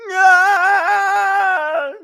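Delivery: high loud falling scream. Acted. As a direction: wail as you fall. Cry when falling.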